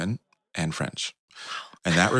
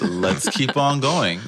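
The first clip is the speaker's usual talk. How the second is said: louder, slow laugh through the words; 8 semitones higher, 5 dB louder, slower than usual. Retold or imitated. Telling the host how the business took off, laughing. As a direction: chuckle it out, delighted.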